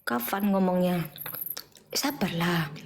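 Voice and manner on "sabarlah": whispered plea to be patient; telling viewers to be patient in a whisper. Real, not acted.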